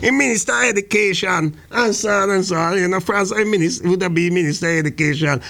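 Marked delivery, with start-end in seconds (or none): none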